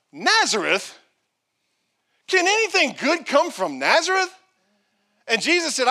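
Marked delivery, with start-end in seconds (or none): 0.1-4.3 much higher, louder mock scorn; preacher mimicking a sneering Nathanael; hammed-up scoff. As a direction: ham up the skeptic. Retold mocking Nathanael.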